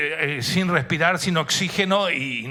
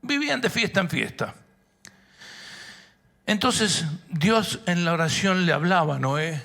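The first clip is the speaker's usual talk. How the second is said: ordinary talk, nothing marked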